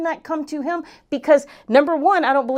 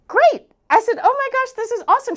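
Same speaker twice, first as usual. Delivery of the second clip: very high retold squeal of congratulation; thrilled; 11 semitones higher than usual. Coach hearing her client made the money, thrilled. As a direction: celebrate the client's news, voice way up. Retelling reaction to client's big news.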